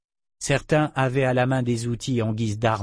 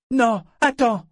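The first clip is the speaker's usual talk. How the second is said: urgent plea; 12 semitones higher, 4 dB louder than usual. A narrator's reading. Man stops the departing dragon.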